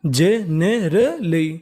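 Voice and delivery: generally very slowly as je-ne-ra-lee, each part stretched; syllable by syllable. Shown as a demonstration. Teacher breaking down a word for learners, syllable by syllable. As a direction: sound out generally in four drawn parts: je, ne, ra, lee. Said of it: "तो meaning बनी".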